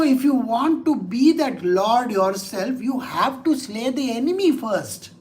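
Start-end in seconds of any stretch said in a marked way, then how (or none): none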